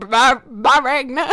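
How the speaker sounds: put-on slow, stuttered, botched Jamaican accent; panicked accent attempt. Imitating singer panicking at an accent, stumbling. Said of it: "like, panicking".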